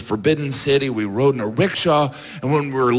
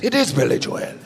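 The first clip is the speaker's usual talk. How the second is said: Irish "Joel" as jwail, calm; mild Irish certainty; Irish comic; 5 semitones higher than usual. Retold or imitated. Irish opening act backing sound guy, mild Irish. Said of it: "the Irish comic goes".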